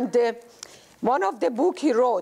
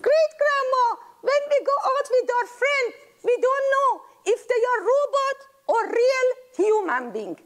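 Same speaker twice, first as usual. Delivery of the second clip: put-on much higher, fast little-kid voice; child voice; 12 semitones higher than usual. Great-grandchild explaining robots to great-grandma in a high child voice. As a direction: chirp it out like a small child.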